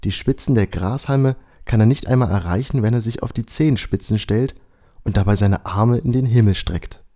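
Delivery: plain reading voice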